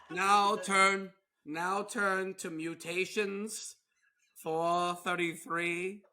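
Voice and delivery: loud, slow pulpit announcement; mock-solemn church voice, projected; imitated preacher. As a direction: announce the reading like a preacher, drawn out. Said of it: "you could be like".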